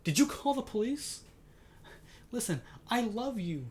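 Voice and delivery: high, alarmed wife voice, lightly played; panicky, pleading questions; frantic wife. Wife in the trailer, pleading with her husband, mildly panicked. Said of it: "She's like".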